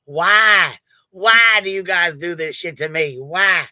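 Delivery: loud retold exasperated wail on WHY; mad. Streamer retelling reaction to daughter's accidental order, mad. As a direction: replay your outraged protest, raising the volume. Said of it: "I was mad as fuck".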